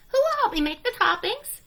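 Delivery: a bright little hen; hen's voice. A narrator's reading. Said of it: "she said".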